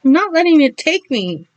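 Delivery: frightened defiant shout; defiant cry. Acted. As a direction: cry out against being taken. Robin resists, fist shaking.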